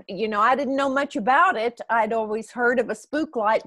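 ordinary talk

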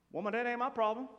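mock brush-off; dismissive reading. Preacher misreading Jesus' reply to his mother, mock dismissive. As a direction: say it like a curt refusal to help. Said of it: "Say it like that".

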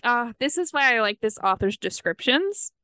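ordinary talk

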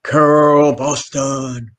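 loud, drawn-out booming call. Retold or imitated. Man hails Carol as she walks in, booming. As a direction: boom the name slowly across a room. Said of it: "this man with this booming voice".

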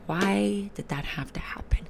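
whispered, rueful aside; soft unvoiced whisper of exasperation. Real, not acted. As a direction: breathe it out under your breath in dismay. Exasperated aside after seeing ex.

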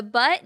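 "but" said loud and punched; stress on but. Shown as a demonstration. Warning her partner that "but" ruins an apology, loud stressed "but". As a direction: hit the word "but" hard and loud. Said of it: "negates everything".

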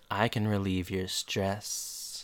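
slow, low, soft come-on; imitated husband's sultry offer; mock seductive. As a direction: purr it like a hammed-up seducer. Husband coming on to stressed wife.

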